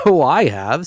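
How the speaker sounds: high and loud, cheerful; lightly voiced good-humoured young man; jolly nephew. A narrator's reading. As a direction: counter her brightly.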